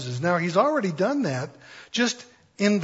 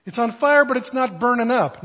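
much higher "fire", rising in wonder; pitched-up astonishment; amazed emphasis; 6 semitones higher, 5 dB louder than usual. Retold or imitated. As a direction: marvel at the impossible. Building amazement at bush.

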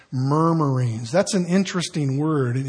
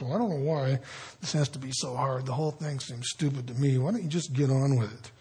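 muttered complaining, much softer; under your breath; 4 semitones lower, 9 dB quieter, faster than usual. Retold or imitated. Believer grumbling to God under their breath. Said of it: "that idea of kind of under your breath".

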